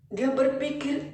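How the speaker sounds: ordinary delivery, nothing marked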